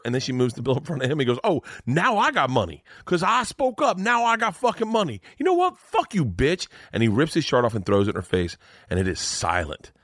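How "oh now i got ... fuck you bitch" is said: high-pitched sarcastic rant building to an insult; indignant outburst. Retold or imitated. Celebrity turning on waitress over money, outraged. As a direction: imitate a furious celebrity, high and escalating.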